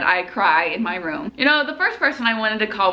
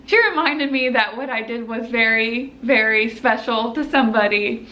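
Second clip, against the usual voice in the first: ordinary talk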